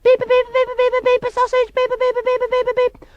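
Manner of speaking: loud, high-pitched mimicked beeps; vocal beep jingle. Newsreader separates items with a loud, high beeping jingle. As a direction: squeal out a news jingle in beeps. Spoken jingle before news bulletin.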